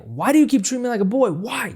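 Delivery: fast, high mock confrontation; mock mad. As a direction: act out a whiny angry boy. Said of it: "just being mad at her".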